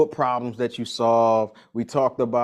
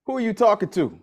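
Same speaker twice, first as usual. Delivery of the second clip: higher, stern mama comeback; 9 semitones higher than usual. Retold or imitated. Mama scolding her kid for talking back. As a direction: channel the mama checking your tone. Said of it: "The first thing she say".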